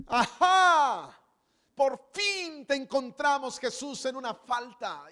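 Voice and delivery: loud, slow gotcha; triumphant accusation. Acted. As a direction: gloat loudly over each word. Pharisee catching Jesus out.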